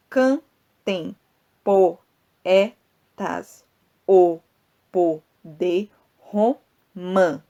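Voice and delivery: Cantem poetas o poder romã slowly broken into syllables, with pauses; scanning syllables. Shown as a demonstration. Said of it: "vou fazer aqui as pausazinhas".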